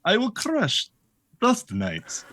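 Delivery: lightly voiced Minsk, Russian-ish; hint of a big friendly brute. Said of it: "I imagined my character as Minsk".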